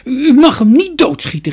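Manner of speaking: high, loud objection; distressed high-pitched protest; crying out in protest. A narrator's reading. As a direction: shout it out, upset. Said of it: "riep Jorkos".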